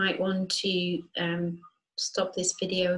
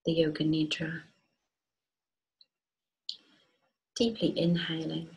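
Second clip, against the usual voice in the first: calm, unhurried meditation voice with long pauses; guided meditation. Acted. Teacher guiding listeners to feel grounded and rooted, guided meditation. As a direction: guide them gently into rest, leaving space between phrases. Leads a yoga nidra meditation.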